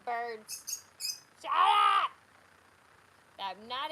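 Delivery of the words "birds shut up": sudden loud high yell; shout at the birds. Real, not acted. Yelling at the noisy birds.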